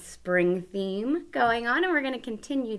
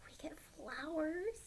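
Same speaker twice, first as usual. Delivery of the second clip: hushed whisper; 8 semitones higher, 12 dB quieter than usual. Shown as a demonstration. Teacher whispering to kids before the flower verse. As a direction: drop to a whisper to build wonder.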